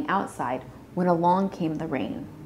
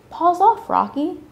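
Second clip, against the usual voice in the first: high, loud warning to a pet; raised-voice child's scolding; playful scolding; 11 semitones higher, 6 dB louder than usual. A narrator's reading. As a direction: tell the pet off, pitched up.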